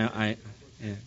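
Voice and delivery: ordinary delivery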